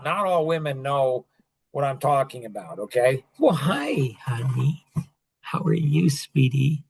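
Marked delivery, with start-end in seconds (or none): 3.4-6.8 put-on sweet talk to a baby. Talking to crying baby.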